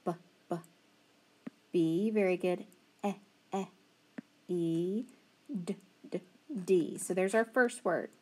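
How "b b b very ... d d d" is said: each letter sound repeated; sounding out bed. Shown as a demonstration. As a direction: tap out b, e, d. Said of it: "What do you hear in bed".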